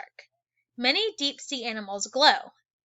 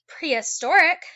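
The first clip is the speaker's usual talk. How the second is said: Prehistoric said higher and louder, ending on a rise; new word announced; 5 semitones higher, 8 dB louder than usual. Shown as a demonstration. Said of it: "we got some new words over here".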